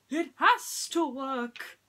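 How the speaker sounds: high, loud, slow pleading insistence; will it to work, desperate; desperate prince. Acted. Prince spins in vain.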